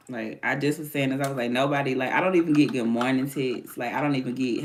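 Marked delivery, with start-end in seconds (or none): none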